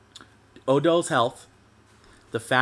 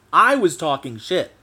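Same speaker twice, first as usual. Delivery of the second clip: slow, louder, leaning on every word; emphatic admission; 3 semitones higher, 6 dB louder than usual. Real, not acted. Fan admits to the audience he was trash talking, slow and emphatic. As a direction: own it, slow and heavy.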